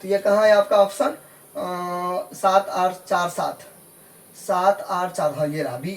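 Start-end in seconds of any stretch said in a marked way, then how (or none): 2.3-3.6 slow, separated "सात, आठ, चार, सात"; digit-by-digit reading. Shown as a demonstration. Teacher looks for the option, reading "सात आठ चार सात" slowly digit by digit. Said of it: "दशमलव के बाद अलग-अलग पढ़ेंगे".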